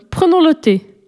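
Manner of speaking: put-on high, eager call from a boy; a boy calls out, keen to start; eager cry. A narrator's reading. Paul calls to his friends, high and eager. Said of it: "s'écria Paul".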